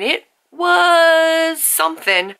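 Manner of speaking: high, slow knowing drawl; loaded understatement. Real, not acted. Vlogger summing up Back River Quiver, high slow knowing drawl.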